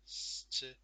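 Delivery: S-T-A-Sh-on spelt out in a breathy sounded-out voice; sounding out. Shown as a demonstration. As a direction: sound out each part. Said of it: "write it down".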